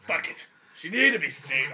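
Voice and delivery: loud, defiant action-hero vow; hammed-up bravado; heroic loud declaration.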